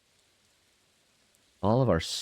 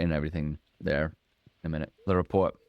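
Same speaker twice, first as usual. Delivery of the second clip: ordinary delivery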